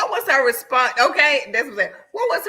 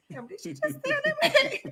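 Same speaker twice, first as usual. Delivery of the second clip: high, soft, drawn-out disbelief; shocked aside; 6 dB quieter, slower than usual. Retold or imitated. Wife kicking husband under the table, hushed shocked aside. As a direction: squeak it under your breath, amused.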